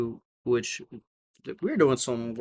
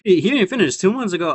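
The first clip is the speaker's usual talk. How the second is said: shocked; 8 semitones higher, 9 dB louder than usual. Acted. Shocked at the chain's answer.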